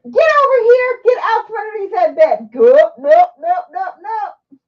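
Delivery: loud, high-pitched commands; shouting at the dog. Real, not acted. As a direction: yell orders across the room to a pet.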